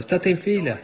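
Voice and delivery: high, loud shouted command; shouting. Retold or imitated. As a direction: cry it out as if waking from the vision. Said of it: "stavo in piedi nella stanza gridando".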